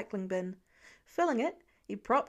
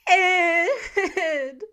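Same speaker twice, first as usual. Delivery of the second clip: put-on high, loud child's cry for Dad; child calling out; 7 semitones higher, 10 dB louder than usual. A narrator's reading. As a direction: yell from upstairs for Dad. Upset boy calls his father.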